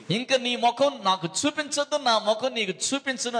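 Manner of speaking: fed-up retort. Retold or imitated. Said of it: "విరక్తి చెంది".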